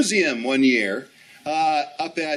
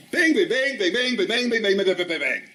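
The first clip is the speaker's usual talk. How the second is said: rapid-fire listing; 3 semitones higher, 4 dB louder than usual. Retold or imitated. Rattles off what he learned.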